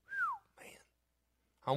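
whew comes out as a high whistle; a whistled whew; preacher's whew slips into a whistle; accidental whistle. Real, not acted. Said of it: "I'm whistling again".

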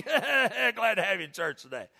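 wrong welcome. Shown as a demonstration. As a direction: laugh through a hammed-up, teasing welcome. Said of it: "No, don't do that".

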